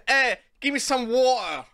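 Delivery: put-on playful British slang accent; mock Cockney British. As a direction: imitate a Cockney, dropping the T. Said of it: "ich denk mehr British".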